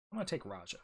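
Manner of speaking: usual speaking voice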